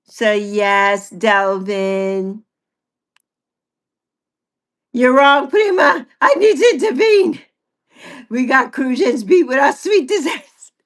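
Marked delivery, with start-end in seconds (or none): 4.9-7.4 loud, higher mock protest; playful challenge. Real, not acted. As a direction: cut in loudly, teasing. Playful rival interrupts.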